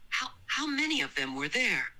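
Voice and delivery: falter, then ask while fighting tears; tearful, stumbling question; holding back tears. A narrator's reading. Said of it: "trying to hold back tears".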